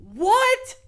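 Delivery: sharp high startled What; shocked what. Real, not acted. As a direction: react in real disbelief. Shocked at award list.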